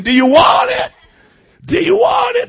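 high, loud, cracking preacher cry; preaching shout. Retold or imitated. Young preacher shouting at four listeners. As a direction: shout the question at the crowd. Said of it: "That's what I kept saying".

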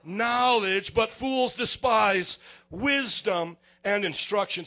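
slow, loud preached verse; preaching scripture. Acted. Preacher reading Proverbs to the church, slow loud proclamation. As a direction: proclaim it slowly to the room. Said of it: "it says".

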